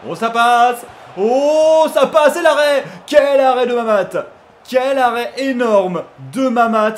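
loud, high shouts at a huge save; excited commentary. Real, not acted. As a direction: cry out in amazement at the keeper's save.

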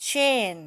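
"chain" high, loud and long, then falling; stressed syllable. Shown as a demonstration. Teacher reviews the syllables, stressed syllable. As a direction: punch "chain" alone as the first part. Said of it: "เน้นที่พยางค์ที่หนึ่ง".

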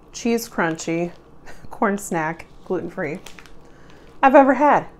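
eating while talking; while chewing. Real, not acted. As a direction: mouth partly full of snack. Seen 2: snack held up, lips pressed and working between words.

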